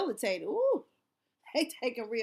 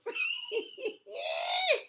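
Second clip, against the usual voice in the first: very high shrieking laugh; shrieking laughter; 4 dB quieter than usual. Real, not acted.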